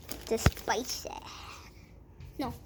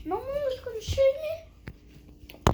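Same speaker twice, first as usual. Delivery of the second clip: higher, slow tearful voice; crying; 10 semitones higher, 4 dB quieter than usual. Pretend. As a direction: cry it out like a hurt child. Pretend rescue drama.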